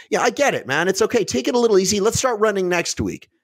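coddle him like a too-nice buddy. Retold or imitated. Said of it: "a friend that goes".

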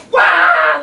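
high loud wah as he lunges; excited shout. Retold or imitated. Boy lunging at the frog with his net, loud cry. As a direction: yell as you pounce. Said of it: "like that".

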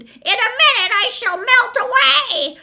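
very high, piercing witch shriek; full-force screaming witch; witch's scream. A narrator's reading. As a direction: scream the accusation in horror. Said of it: "she screamed".

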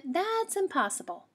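mother rabbit saying it cannot happen; firm mother. Acted. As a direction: end the discussion like a mom.

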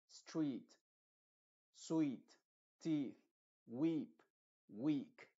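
each word read out one by one with a long ee; clear, careful model words for learners; long ee word list. Shown as a demonstration. Teacher reading example words to students: careful long ee. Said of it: "los ejemplos serían los siguientes".

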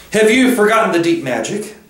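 high, challenging witch voice; imperious witch. A narrator's reading. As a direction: challenge him as the haughty witch.

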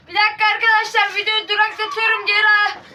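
high, rushed and flustered; pausing the video in a panic; flustered by the rooster, telling viewers he'll pause. Real, not acted. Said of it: "Çok riskli bir anla-, andayız".

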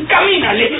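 loud, high, laughing command; barked order. Acted. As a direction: shout "¡Camínale!" with a mean laugh. Toño orders her at gunpoint.

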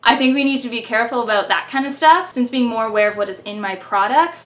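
usual speaking voice

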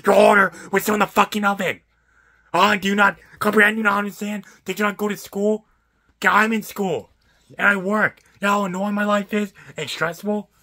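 loud, high-pitched frustrated tirade; exasperated rant. Real, not acted. Pizza worker venting about a customer who won't understand, exasperated.

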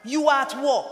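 impassioned sermon voice; full-force preaching. Acted. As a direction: preach it hard to the congregation.

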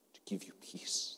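gentle unvoiced whisper. Whispering an offer of peace to the weeping mother. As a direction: hush it like a gift.

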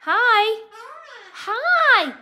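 high, loud, drawn hello; pet-directed greeting. Real, not acted. Owner greets pet bird with attitude, high loud calling. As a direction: call brightly up high to the bird.

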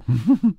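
loud high burst of laughter; hearty laugh. Acted. Robin laughs loudly at the beggar's wit.

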